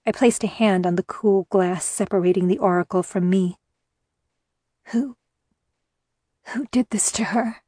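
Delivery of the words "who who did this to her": breathless hoarse whisper. A narrator's reading. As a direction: rasp it out in a near whisper. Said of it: "I asked hoarsely".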